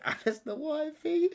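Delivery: high squeaky line through laughter; laughing mock voice. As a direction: giggle it out in a silly high voice.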